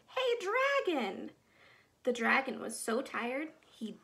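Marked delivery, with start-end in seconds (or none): 0.1-1.3 a higher, mild shout into his ear. Acted. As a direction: yell right inside the dragon's ear. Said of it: "She shouted as loud as she could".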